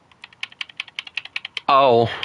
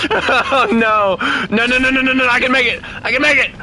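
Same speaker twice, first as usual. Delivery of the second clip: panicked shouting; 11 semitones higher than usual. Real, not acted. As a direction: scream it through the panic. Desperate crash landing attempt.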